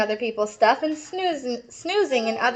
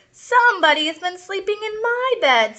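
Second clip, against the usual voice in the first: higher mother's voice; lightly voiced mum, alarmed; mom voice; 8 semitones higher than usual. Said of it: "said the mommy person".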